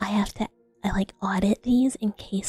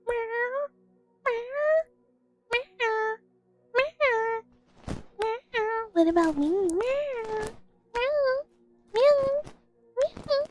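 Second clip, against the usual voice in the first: much higher, mewing like a needy cat; cat meowing; 12 semitones higher, much slower than usual. Acted. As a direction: meow up high like a jealous little cat. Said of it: "And then Mister Meow Meow goes".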